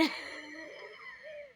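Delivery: a high squealing laugh; high squeaky laugh. Real, not acted. Laughs at own stumble.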